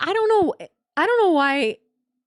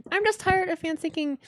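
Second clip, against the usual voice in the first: ordinary talk, nothing marked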